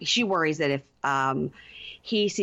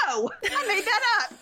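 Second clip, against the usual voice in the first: squeaky playful voice, much higher; high teasing confession. Retold or imitated. Co-host Veronica admitting her joke to Tim, squeaky and playful. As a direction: pipe it up high like a giggly tease.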